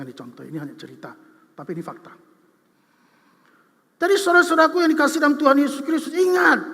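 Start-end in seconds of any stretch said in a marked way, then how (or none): 4.0-6.6 loud, higher sermon delivery, hammering each phrase; a pastor pressing humility on the church, in forceful preaching; full-force preaching. Acted.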